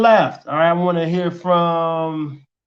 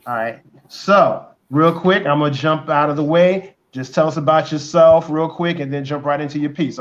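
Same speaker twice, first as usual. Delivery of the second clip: usual speaking voice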